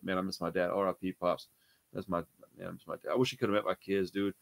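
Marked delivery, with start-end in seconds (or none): 0.0-3.1 low, softening voice, missing his dad; real sadness, trailing into near silence; getting emotional. Real, not acted. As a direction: let it sink quieter as grief creeps in. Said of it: "I do get emotional talking about my dad".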